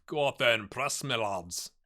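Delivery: ordinary talk, nothing marked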